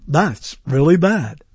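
slow, low, deadpan verdict; fake cart sound. Shown as a demonstration. Radio host analoging his sound cart, slow and low. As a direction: play back the canned line slowly. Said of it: "imitating the sound of a cart".

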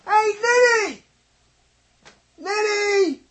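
very high, thin mock scream; screaming subconscious. Subconscious yelling at him over a mistake, very high mock scream. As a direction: scream it from far back in the head. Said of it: "my subconscious in the back".

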